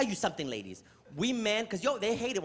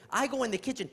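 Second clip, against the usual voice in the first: ordinary delivery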